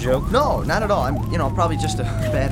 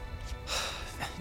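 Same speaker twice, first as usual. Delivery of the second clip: weary sigh; 15 dB quieter than usual. Acted. Weary sigh, feeling alone.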